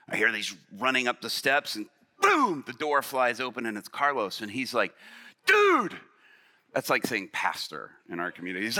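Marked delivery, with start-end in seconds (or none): 2.2-2.6 loud, high exclaimed sound effect; explosive boom. Retold or imitated. Imitates the door bursting open. 5.5-6.0 very high, loud mock-panicked cry; alarmed shout. Carlos bursts in with news.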